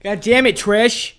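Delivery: put-on loud Boston accent through laughter; Bostonian accent. Friend joining the bit, loud Bostonian accent. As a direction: exclaim it high and loud in Bostonian.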